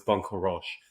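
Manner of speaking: Frenchify bon courage as a correction; French accent. Retold or imitated. Friend correcting someone reading a tattoo, mock French accent. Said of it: "like, a French accent".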